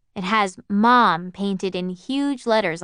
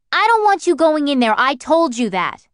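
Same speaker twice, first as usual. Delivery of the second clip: loud, high, stressing don't; scolding mother; 7 semitones higher, 7 dB louder than usual. Acted. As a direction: lay down the law, angry. Mother forbids the luncheonette.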